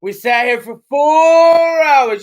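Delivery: loud, drawn-out mock outrage; hammed-up, slow incredulous complaint; mock scolding. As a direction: stretch the words in playful exasperation. Mock scolding over a bracelet.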